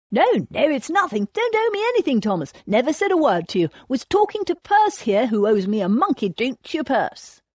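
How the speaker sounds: high, hurried placating babble; frantic backpedalling. A narrator's reading. Cornelius placating an angry Thomas, flustered. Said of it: "He held up his hands pacifically".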